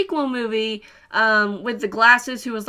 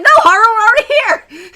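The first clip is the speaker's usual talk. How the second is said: much higher and louder, laughing through it; playfully imitating Makoto begging Haru to stay; laughing protest; 11 semitones higher, 12 dB louder than usual. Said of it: "and Makoto's like".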